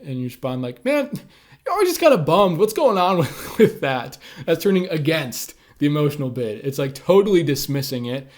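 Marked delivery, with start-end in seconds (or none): 0.8-4.2 higher and louder, mocking jab; hostile sarcasm. Partner dismissing the bid with a sarcastic, mocking tone. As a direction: needle them for always being down. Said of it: "hostilely or sarcastically".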